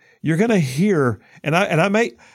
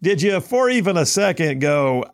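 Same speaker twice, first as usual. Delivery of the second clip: normal talking voice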